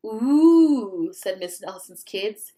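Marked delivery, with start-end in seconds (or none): 0.1-1.1 awed ooh. Acted. Kids reacting in awe.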